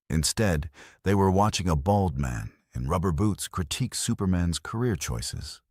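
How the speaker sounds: plain reading voice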